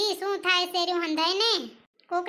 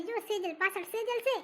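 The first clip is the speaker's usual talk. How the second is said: hushed warning; 3 semitones higher, 6 dB quieter than usual. Acted. Gossiping neighbour warns friend that Sejal is behind, hushed. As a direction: drop the voice to warn a friend. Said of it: "થોડુંક જોરથી બોલો ને".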